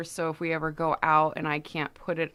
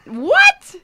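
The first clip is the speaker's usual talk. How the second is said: high, loud disbelieving what; shocked what; 12 dB louder than usual. Real, not acted. Co-host is stunned by the refusal, high shocked yelp.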